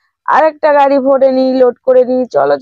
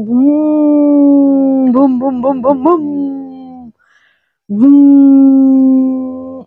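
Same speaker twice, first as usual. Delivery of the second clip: engine noise; 2 semitones lower, much slower than usual. Retold or imitated. Truck drives off.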